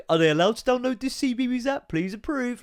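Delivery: put-on reading out the kids' app request in a silly high voice; mock app prompt. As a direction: parody a phone asking permission. Imitating phone approval notification.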